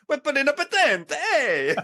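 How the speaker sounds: high, bouncy Italian-ish cartoon voice; hammed-up Mario plumber accent; Luigi voice. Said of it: "you sounded like Luigi from Mario Brothers".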